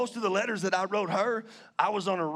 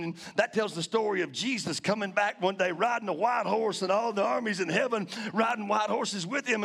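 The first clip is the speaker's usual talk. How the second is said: ordinary talk, nothing marked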